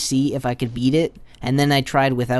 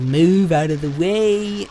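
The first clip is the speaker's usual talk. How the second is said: drawn-out, raised-pitch call to move; slowly whine-yell at someone blocking you; stretched, higher, louder mock plea; exasperated mock yell; 4 semitones higher, 4 dB louder, slower than usual. Acts out pleading with blocking monster.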